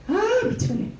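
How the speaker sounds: high exasperated outburst. Retold or imitated. Fed up with the drunk man, high exasperated cry. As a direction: throw up your hands in frustration.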